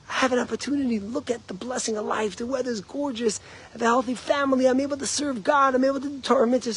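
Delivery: cheerful counting of blessings; grateful attitude. Retold or imitated. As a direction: sound glad for the chance. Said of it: "two attitudes".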